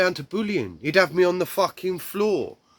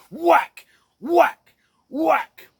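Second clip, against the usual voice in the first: high, loud, separate hits; explosive high-pitched sound effects; mimed whacks; 13 semitones higher, 5 dB louder, much slower than usual. Retold or imitated. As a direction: fire off each word like a blow. Acts out blows to the legs.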